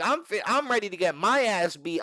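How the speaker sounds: usual speaking voice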